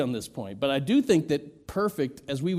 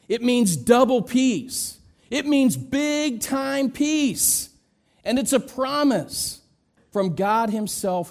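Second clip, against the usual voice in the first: slow, higher and louder; emphatic preaching; 8 semitones higher, 5 dB louder than usual. Acted. Preacher pressing the meaning on the congregation, slow and loud. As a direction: drive home double peace. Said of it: "for emphasis".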